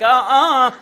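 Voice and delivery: loud, sassy no-way uh-uh; defiant refusal. Retold or imitated. Driver firing back a spotlight, loud and sassy. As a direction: shut them down with attitude. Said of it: "I'm just like".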